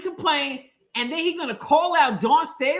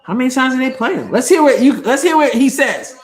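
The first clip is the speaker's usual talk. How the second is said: loud outburst; 8 dB louder than usual. Real, not acted. Yelling at the TV broadcast.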